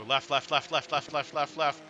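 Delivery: race spotter radio. Pretend. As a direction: rattle off left calls like a spotter. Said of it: "Get your spotter call".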